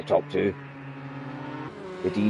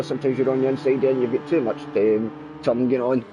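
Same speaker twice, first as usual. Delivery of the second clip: usual speaking voice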